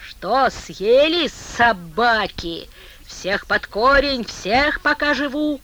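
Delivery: slow, loud, unhinged gloating; madman ranting. Acted. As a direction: rant like a man gone mad. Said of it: "закричал безумный человек и также безумно засмеялся".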